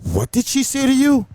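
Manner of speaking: high-pitched outraged question; shocked demand. Retold or imitated. Coordinator overhears guest yelling and asks DJ, shocked. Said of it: "heard her yelling at me".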